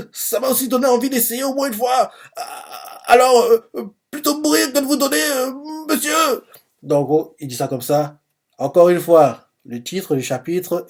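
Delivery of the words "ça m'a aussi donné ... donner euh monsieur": put-on much higher stuttering kid voice, halting; stammering boy. Ten standing up to Doppo for his sister, high stammering boyish voice. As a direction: stumble through it like a nervous boy. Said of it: "il bégaye, hein, quand il parle".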